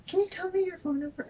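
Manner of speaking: high, quick imitation of a cashier; mock officious cashier. Cashier asking customer for her phone number, mock officious.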